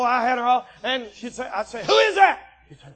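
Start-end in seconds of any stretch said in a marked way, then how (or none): 1.8-2.4 high, loud cry of alarm; sudden loud mock-frightened question; startled question. Child's exclamation in retold bedtime story.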